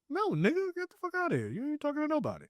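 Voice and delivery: high-pitched mock outrage; high, incredulous comic brush-off; indignant retort. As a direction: squeal an indignant dismissal without raising the volume. Acts out angry reply to suitor.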